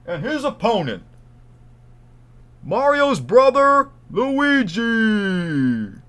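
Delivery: loud, high, drawn-out fight introduction; ring announcer. Pretend. Introducing Donkey Kong to a wrestling crowd, ring announcer. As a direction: bellow it slowly like a ring announcer. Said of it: "I can be like a ring announcer".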